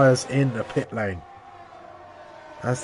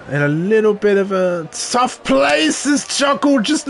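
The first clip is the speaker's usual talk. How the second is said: higher, rising excitement as the car slides; excited commentary; 7 semitones higher than usual. Acted. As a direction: call the near-crash with alarm. Car loses the rear, live call.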